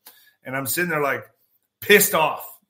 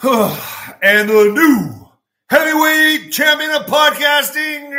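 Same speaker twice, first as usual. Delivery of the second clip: very slow, much louder and higher booming build-up; ring announcer; 11 semitones higher, 8 dB louder, much slower than usual. Acted. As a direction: announce him like a title fight. Mock boxing announcer outro.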